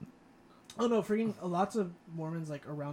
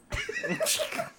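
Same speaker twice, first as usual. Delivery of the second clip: high squeal imitation; 3 dB louder than usual. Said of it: "You can't even describe what you just did".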